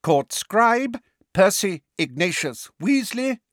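high-pitched, formal proclamation; ringing voice. A narrator's reading. Fudge announcing the charge and interrogators, ringing voice. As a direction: read out the charge and names ringingly. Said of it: "said Fudge in a ringing voice".

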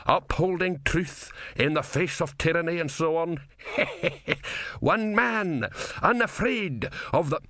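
high-pitched gleeful declaiming with a chuckle; happy, self-pleased. A narrator's reading. Philosopher happily revelling in upholding truth, gleeful high voice. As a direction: relish each grand phrase, pitched well up. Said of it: "murmured Didactylos happily".

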